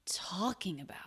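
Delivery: talking opened with a strong airy T; breathy T burst starting talking; stressed first sound. Shown as a demonstration. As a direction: puff hard on the T of talking, about tapering off. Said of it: "stressing the first sound of a stressed syllable".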